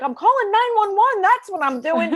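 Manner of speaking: high, loud frantic yell; loud panicked shout about the phone call; husband yelling. Retold or imitated. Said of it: "he starts screaming".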